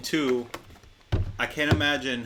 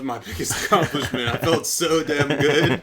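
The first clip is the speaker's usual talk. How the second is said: laughing; 3 semitones higher, 5 dB louder than usual. Real, not acted. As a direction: laugh through it. Laughing recollection.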